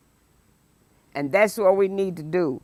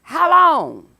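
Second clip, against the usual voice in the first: high, loud anguished how long; anguished cry of the prophet; 8 semitones higher, 8 dB louder than usual. Acted. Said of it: "Habakkuk opens with the words".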